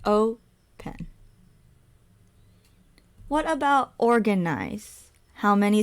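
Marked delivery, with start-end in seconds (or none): 0.0-1.0 "Open" split into O, pause, pen; syllable by syllable. Shown as a demonstration. Teacher showing the syllable count, "Open" in two spaced parts. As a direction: say "Open" as two separate beats. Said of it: "is also two syllables".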